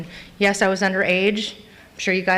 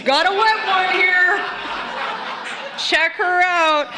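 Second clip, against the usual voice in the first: higher-pitched, jeering call; amused cop partner; 8 semitones higher, slower than usual. Acted. Cop's partner showing off a drunk driver, amused jeer. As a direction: call it out to a fellow officer. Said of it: "and his partner's like".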